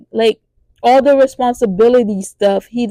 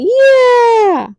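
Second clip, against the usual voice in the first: answering shout; 5 dB louder than usual. Retold or imitated. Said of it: "everybody was like".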